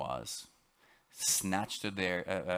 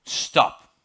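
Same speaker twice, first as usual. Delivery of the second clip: sudden, forceful shouted command; demonstrated shout; 16 dB louder than usual. Retold or imitated. Speaker shows how they stopped the bear, demonstrated shout. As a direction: re-enact a loud warning shout. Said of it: "A little louder, but you get the point".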